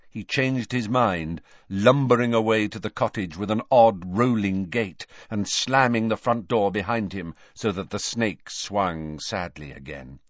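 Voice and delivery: ordinary delivery, nothing marked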